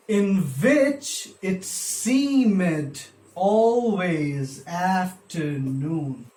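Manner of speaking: very slow, SEEMED as two beats, ALWAYS and AFTERNOON stretched; scanning the meter. Shown as a demonstration. Teacher scanning the poem's line, very slow metrical beats. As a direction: draw out every beat of "seemed always afternoon". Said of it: "iambic, iambic".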